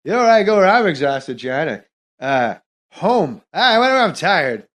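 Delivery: uncomfortable, dragged-out stand-up impression; Norm Macdonald impression. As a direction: ramble and circle back, stretching key words. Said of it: "I was doing Norm Macdonald".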